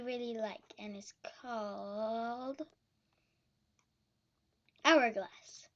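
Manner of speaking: hushed whisper, breathy and secret. Real, not acted. Secret game name whispered.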